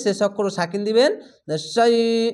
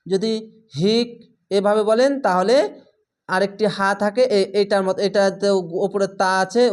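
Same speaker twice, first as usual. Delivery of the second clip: usual speaking voice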